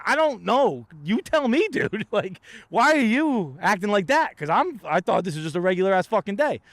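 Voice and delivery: high, pushed-up exasperated voice; mock indignant rant. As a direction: play yourself baffled and half-laughing at the agent. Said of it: "I'm like".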